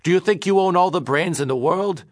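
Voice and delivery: high, loud angry outburst; losing his temper. Acted. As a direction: snap at him in rage. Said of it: "Gus lost his temper".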